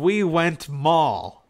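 said slowly and loudly, dropping the to; mock the dialect loudly and slowly; slow mock Michigan phrase. Shown as a demonstration. Showing a friend the Michigan habit of dropping to, loud and slow. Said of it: "you don't use the word to".

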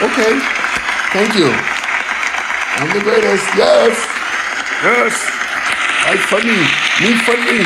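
goofy proud self. Retold or imitated. Comic talking himself up to his wife, high and dopey. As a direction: puff up like a dumb happy kid. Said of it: "And I'm like".

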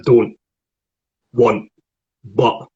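"don't", "want", "but" each cut off before the T; dropped final T. Shown as a demonstration. Coach showing Scottish word endings, final T dropped. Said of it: "that's when we don't pronounce the T".

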